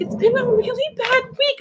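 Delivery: very high, whiny near-crying voice; tearful woman. Retold or imitated. Crying customer tells the stylist, high tearful. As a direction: mimic her crying pitch. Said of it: "She's like".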